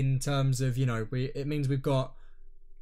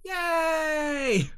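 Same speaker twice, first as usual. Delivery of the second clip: high shouted yay; excited cheer; 12 semitones higher than usual. Pretend. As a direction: cheer it out like a fan. Cheering the new season.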